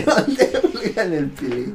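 teasing friend, laughing as he repeats it. Real, not acted. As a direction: giggle the joke out again. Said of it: "no digas esa palabra".

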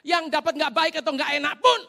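high, projected sermon voice; emphatic preaching. Real, not acted. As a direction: drive the point home at high pitch. Preaches at full force. (berkhotbah lantang dengan nada tinggi)

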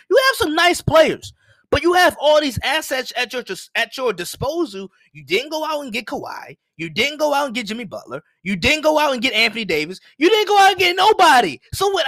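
worked-up, high-pitched list of grievances; exasperated rant. Retold or imitated. Sports host retelling his rant about Celtics trades, exasperated. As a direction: pile up the complaints, pitch jumping on the last.

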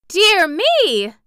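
high, loud startled exclamation; exclaiming. Acted. Dorothy interrupts the droning woman, high startled exclamation. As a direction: cut in with a cry of dismay. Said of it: "exclaimed Dorothy, interrupting".

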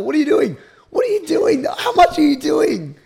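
high, loud alarmed yelling; shocked shout. Real, not acted. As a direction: yell at him in disbelief. Friend squirts too much soap.

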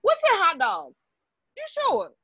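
high-pitched mimicry of a nosy friend; Kayla impression. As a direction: put on a high, questioning friend voice.

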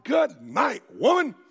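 loud, high "Good night, woman"; exasperated husband. Retold or imitated. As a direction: bark it out to end the argument. Samson shuts down nagging wife.

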